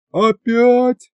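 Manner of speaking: high, plaintive groan of a question; dismayed whine; saddened crowd. A narrator's reading. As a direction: ask it with sinking disappointment. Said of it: "загрустила площадь".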